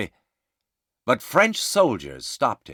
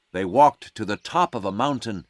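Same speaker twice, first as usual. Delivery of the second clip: plain reading voice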